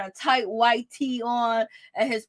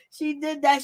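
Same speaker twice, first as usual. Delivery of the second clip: ordinary talk, nothing marked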